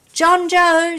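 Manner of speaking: high, loud call; a sister shouting for the lost boy, high and loud. A narrator's reading. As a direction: shout his name out over the farm. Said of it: "shouted Mary".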